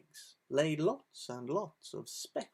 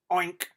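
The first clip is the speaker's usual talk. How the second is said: pig oink; 2 semitones higher, 7 dB louder than usual. Acted. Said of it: "said the hog".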